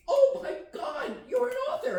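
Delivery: a fan spotting an author on the beach, high and thrilled; excited gasp. Retold or imitated. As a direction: squeal it like meeting a celebrity. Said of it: "she said".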